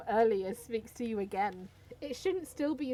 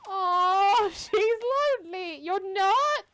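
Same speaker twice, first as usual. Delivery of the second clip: mock pity; 8 semitones higher, 7 dB louder, much slower than usual. Pity for lonely character.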